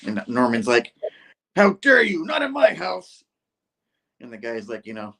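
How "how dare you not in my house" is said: high, loud indignant cry; acted outrage. Norman fighting back against his attacker, high outraged yell. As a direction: burst out high in mock fury.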